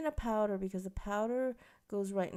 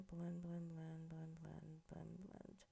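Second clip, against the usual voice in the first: blend, blend, blend, trailing off much softer each time; a repeated murmur that fades almost to nothing; fading murmur; 3 semitones lower, 12 dB quieter, slower than usual. Real, not acted. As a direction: mumble it to yourself as it fades away. Chanting while blending brows.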